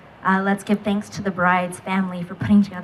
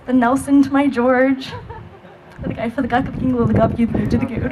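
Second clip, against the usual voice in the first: laughing; 10 semitones higher than usual. Real, not acted. Bridesmaid laughing through her toast.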